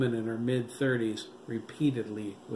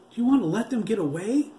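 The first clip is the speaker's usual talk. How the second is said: high, louder, fast angry snap; 10 semitones higher, 6 dB louder, faster than usual. A narrator's reading. Fast, angry high snap. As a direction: brother shaking his brother to follow. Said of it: "J T snapped".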